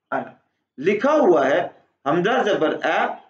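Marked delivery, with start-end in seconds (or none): 0.1-0.4 अना with a short final a; अना, न not stretched; short ana. Shown as a demonstration. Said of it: "क्या पढ़ते हैं जी?". (अना छोटा पढ़ें)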